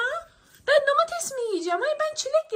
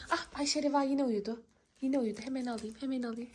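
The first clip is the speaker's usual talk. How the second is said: low, very soft stealthy murmur; furtive hush; 9 semitones lower, 9 dB quieter, faster than usual. Acted. Girl sneaks toward sleeping Ayşe Reva's candy, furtive hush. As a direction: creep up on the sleeper with your voice.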